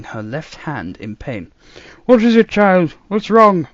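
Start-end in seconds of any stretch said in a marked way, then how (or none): 2.0-3.7 put-on louder, alarmed old man; worried shout. A narrator's reading. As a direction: shout in concern. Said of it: "the doctor shouted".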